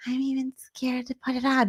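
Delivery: mildly mocking a frightened housemate; imitating timid fear. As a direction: put on a timid, softened voice. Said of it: "does everything terrify her".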